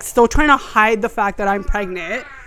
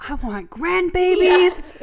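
tipsy longing; 9 semitones higher, much slower than usual. Retold or imitated. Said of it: "Kelly was like".

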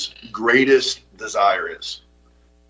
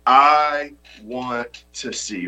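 slow title; much slower than usual. Shown as a demonstration. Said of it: "The title of my message today is".

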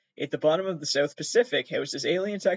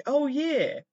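ordinary talk

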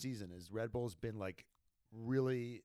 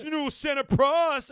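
mocking fan voice; 13 semitones higher, 11 dB louder than usual.

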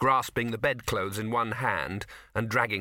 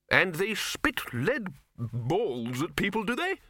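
Ridcully voice; 8 semitones higher, slower than usual. A narrator's reading. Ridcully asks.

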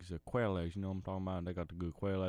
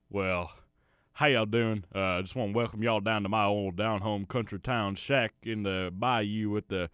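loud, folksy backwoods cousin; 8 dB louder than usual. Acted. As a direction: holler it like a bayou prepper. Daryl the cousin keeps talking.

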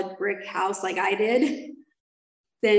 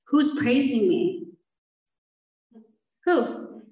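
drawn-out question, then a higher 'who'; retold indignant challenge, pitched up; incredulous retort; 3 semitones higher, much slower than usual. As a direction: throw it back at the accusers in disbelief.

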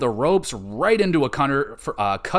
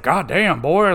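imitating Arn; 4 semitones higher, 4 dB louder than usual. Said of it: "he was like".

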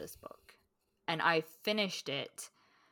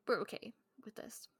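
unvoiced hushed whisper; 5 semitones higher, 7 dB quieter than usual. Reader making peace with the husband's namesake, whispered. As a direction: breathe it as a secret. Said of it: "I was like".

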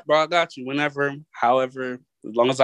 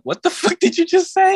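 much higher, laughing through it; laughing in disbelief. Pretend. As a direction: laugh while asking. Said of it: "she would literally laugh and be like".

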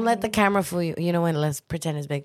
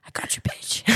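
whispered aside; 6 dB quieter than usual. Real, not acted. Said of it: "aside to friend".

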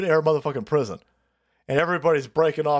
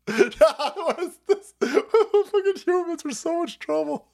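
laughing; 10 semitones higher, slower than usual. Real, not acted. Laughing through mock quote.

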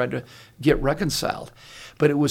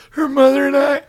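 much higher and louder, a booming proud announcement; proud declaration; 11 semitones higher, 8 dB louder than usual. Retold or imitated. Father announcing at his daughter's wedding, booming and proud. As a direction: proclaim it like a bursting-proud father. Father giving bride away.

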